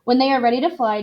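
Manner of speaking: usual speaking voice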